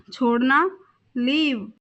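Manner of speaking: ordinary delivery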